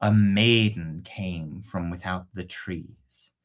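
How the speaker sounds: ordinary delivery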